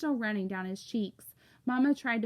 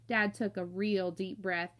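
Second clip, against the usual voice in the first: ordinary delivery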